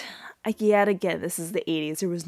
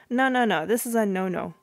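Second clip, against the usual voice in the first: ordinary talk, nothing marked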